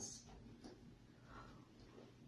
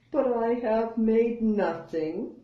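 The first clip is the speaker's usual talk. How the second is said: a high, sighing little bear voice; 7 semitones higher than usual. Acted. Bear sighing to his friend with a sad sigh. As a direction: let the line sink on a sigh. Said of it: "he says with a sigh".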